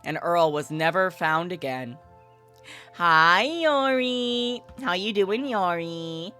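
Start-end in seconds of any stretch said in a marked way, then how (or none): none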